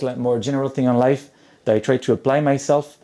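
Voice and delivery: usual speaking voice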